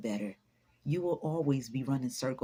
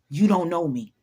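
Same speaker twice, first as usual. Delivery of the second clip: loud, forceful motivational yell; David Goggins; 8 dB louder than usual. Acted. Coaching listeners to tell people off, loud and forceful. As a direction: punch it out hard and loud. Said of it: "In my David Goggins voice".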